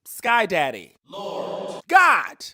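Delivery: loud, rising plea to the sky; calling out to God. Real, not acted. Pleading with Sky Daddy for her people, loud. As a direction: cry out for God to hear.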